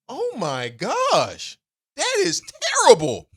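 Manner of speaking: high, indignant protest; appalled outrage. Acted. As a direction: react scandalized, stretching the words then pitching up. Mock outrage at the diss.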